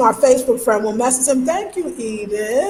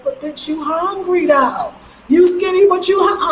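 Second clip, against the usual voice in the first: higher-pitched, loud mock call-out; Monique; 7 semitones higher, 3 dB louder than usual. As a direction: imitate a friend calling someone out, voice jumping up. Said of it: "she said".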